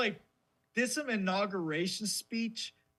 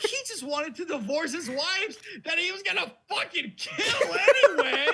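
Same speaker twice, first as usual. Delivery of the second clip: laughing hard; 11 semitones higher, 6 dB louder than usual. Real, not acted. Laughing at Henry's wives.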